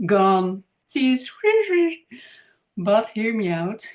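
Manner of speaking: ordinary talk, nothing marked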